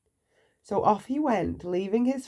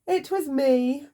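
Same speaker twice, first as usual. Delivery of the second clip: higher, slow, eager little voice; piping up; 8 semitones higher, 3 dB louder than usual. A narrator's reading. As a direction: pipe up proudly. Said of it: "piped up Mister Silly".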